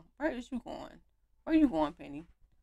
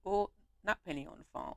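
usual speaking voice

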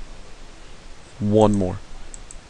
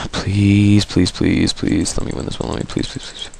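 pleading; 7 dB quieter than usual. Real, not acted. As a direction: beg under your breath, trailing into near silence. Begging the game for a win.

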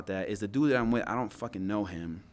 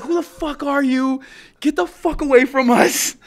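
loud, high-pitched yelling; re-enacted shouted challenge; hostile shout; 13 dB louder than usual. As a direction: imitate a soldier warning off a stranger. Re-enacts soldiers warning him off.

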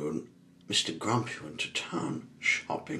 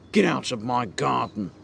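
loud, raised, grumpy command; angry order; 6 semitones higher, 8 dB louder than usual. A narrator's reading. Mister Grumpy throws Mister Happy out, angrily. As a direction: order him off crossly.

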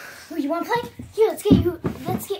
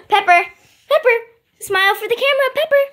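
high, louder coaxing for the camera; excited coaxing; 9 semitones higher, 6 dB louder, much faster than usual. Real, not acted. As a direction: cheer the dog into posing.

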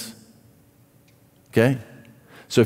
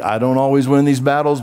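normal talking voice

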